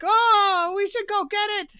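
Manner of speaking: loud, very high gargoyle voice; hammed-up high gargoyle cry; eager gargoyle. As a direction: urge the others on in a high creature voice. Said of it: "Gargoyle six is like".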